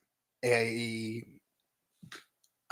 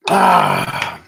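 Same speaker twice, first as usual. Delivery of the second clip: pirate arr; 8 dB louder than usual. Pretend. Pirate joke in the chat.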